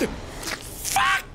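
a high, breathless curse of fright; startled curse. Real, not acted. Gamer jumps at a scare, startled high curse. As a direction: jolt and blurt it out.

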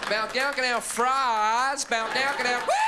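high, shouted American gibberish ending in a whoop; loud parody of American twang in nonsense sounds; mock American. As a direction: babble like an American tourist, building to a cheer. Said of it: "This American man came up to me".

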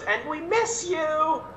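high, drawn-out mock holler; imitated mom's shout. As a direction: call out sweet words like a heckling mom. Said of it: "Now your mom's shouting out".

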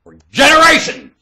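high, loud declaiming; loud, high-pitched verdict; shouted preaching. Real, not acted. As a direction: punch out each word at full voice.

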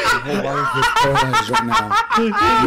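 squeaky high laughing words; words cracking up high. Real, not acted. Laughing in disbelief.

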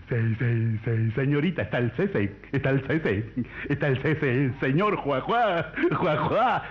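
hammed-up stutter, forcing the name out; stutterer. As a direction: stammer that builds on repeated syllables. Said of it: "Un tartamudo golpea en una casa". (tartamudo cómico, trabándose en cada sílaba)

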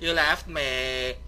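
slow, drawn-out mock lament; annoying YouTuber whine. Player to friend who moved off, hammed-up whine. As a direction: stretch every word out in hammed-up reproach. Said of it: "exhausting talking like this".

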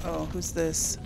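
usual speaking voice

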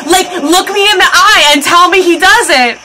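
high, insistent fangirl demand; emphatic challenge. Real, not acted. Daring viewers to disagree he looks good.

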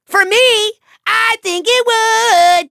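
high, slow, drawn-out relish; gloating. Acted. Villain savoring lightning raining on foe, gloating. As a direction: stretch the words with glee.

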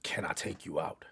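mocking a shy guy, whispered low; timid whisper. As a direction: whisper it like a nervous asker.

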